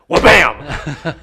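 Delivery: explosive, high "wha-bam" sound effect; big punchy storytelling bang; loud crash imitation. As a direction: shout it like something crashing over. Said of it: "my grill went".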